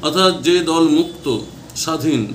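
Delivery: normal talking voice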